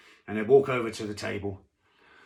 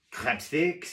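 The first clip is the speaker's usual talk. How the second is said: put-on French waiter accent; 4 semitones higher than usual. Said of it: "'cause he was French".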